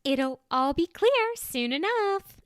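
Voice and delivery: bright, high, breezy brush-off; high-pitched voice. A narrator's reading. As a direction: lift the voice up high and airy. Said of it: "in a high-pitched voice".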